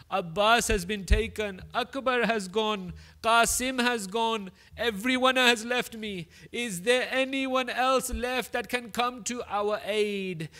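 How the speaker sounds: a lone Imam cries out for help; anguished cry. Acted. Imam's cry of loss, climax.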